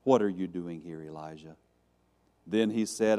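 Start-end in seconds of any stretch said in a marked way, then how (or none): 0.0-1.6 low question, softening on the name; gentle voice of God, pitched low; calm gentle voice. Acted. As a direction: ask it calmly, letting the name fade. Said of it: "calm voice, that gentle wind".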